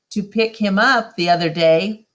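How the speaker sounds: ordinary delivery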